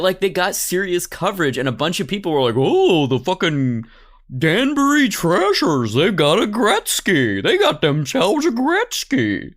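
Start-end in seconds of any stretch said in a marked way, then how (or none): 2.6-9.5 higher, louder, slow mock hype; hyped fan quote. Mocking fans who cheer a Gretzky signing, hyped.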